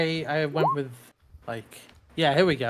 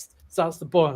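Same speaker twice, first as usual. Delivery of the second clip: ordinary talk, nothing marked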